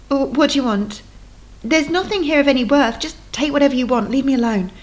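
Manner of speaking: acted fear at gunpoint; frightened plea. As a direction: beg the gunman to leave her be. Sarah faces a man with gun.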